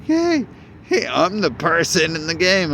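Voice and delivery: high, loud cartoon plumber voice; bouncy Mario-style voice. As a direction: play the cheery game hero, high and loud. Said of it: "I'm a Mario".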